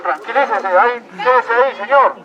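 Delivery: ordinary delivery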